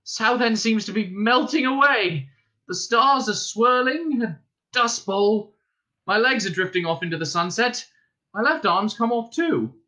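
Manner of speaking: high, wavering-world bewilderment; dazed alarm. A narrator's reading. Arthur watching Southend and his limbs dissolve, dazed alarm. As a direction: pitch it high, stretching out the strangeness.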